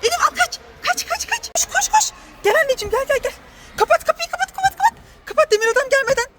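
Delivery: very high, urgent cries to run; mother hamming up a chase for her daughter; excited game shouting. Pretend. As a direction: cheer the child on to flee. Chase game, child grabs plaque.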